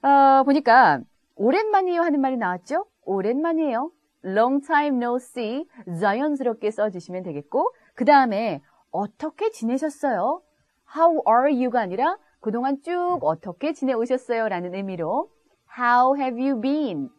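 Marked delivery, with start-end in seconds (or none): 4.2-5.7 spoken slowly for repetition; teacher modelling a phrase slowly for learners; slow model phrase. Shown as a demonstration. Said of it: "따라해보세요". 15.8-17.1 said slowly with clear words; each word laid out slowly for the class; slow model phrase. Shown as a demonstration. Teacher modelling a question slowly for learners. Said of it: "다시 한번 따라해보세요".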